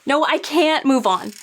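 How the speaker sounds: ordinary delivery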